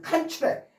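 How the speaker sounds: 칸출액 with the weight on 칸, first syllable high and strong; stress on the first syllable. Shown as a demonstration. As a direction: hit 칸 hard, let 출액 fall away. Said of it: "앞에 강세가 있어서 명사가 되면".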